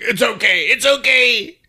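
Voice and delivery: high-pitched tearful imitation; mock crying. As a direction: imitate someone reassuring through tears. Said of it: "she starts crying".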